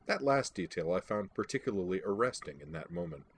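ordinary delivery, nothing marked

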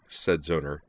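ordinary delivery, nothing marked

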